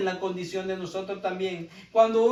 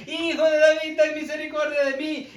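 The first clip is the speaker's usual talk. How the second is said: a higher, louder plea of Hijo de David; crying out louder; 5 semitones higher, 3 dB louder than usual. Retold or imitated. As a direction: call out to Jesus over the crowd, raising the voice. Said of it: "aún en vez de bajar la voz, él insistía". (un clamor más alto y más fuerte del ciego Bartimeo)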